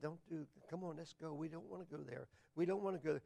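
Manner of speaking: very soft gentle coaxing; tender, merciful. Retold or imitated. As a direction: imitate a tender guide quietly steering someone away. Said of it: "He's tender. He's merciful".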